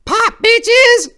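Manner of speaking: squealing very high shout; showman's yell. Acted. Four-way leaping from the shadows, squealing showman shout. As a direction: burst out yelling with a flourish. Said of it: "sounded out of the darkness".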